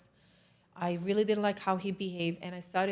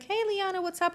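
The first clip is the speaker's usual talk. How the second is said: much higher, breezy hello; imitated friendly greeting; 11 semitones higher, 3 dB louder than usual. Commander greets the soldier back, imitated high and friendly. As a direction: mimic a chummy hello, pitched high.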